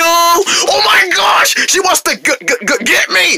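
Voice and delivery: high, panicked squeal; shriek, then stammer the alarm; terrified. Acted. Rabbit realizing the pig wants her, terrified.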